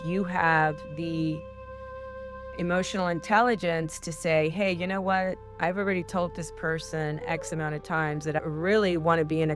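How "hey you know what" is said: ordinary talk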